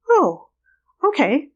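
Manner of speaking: high, startled little oh okay; mock meek surprise. Surgeon told to stop crying, high surprised agreement. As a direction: play her caught off guard and giving in.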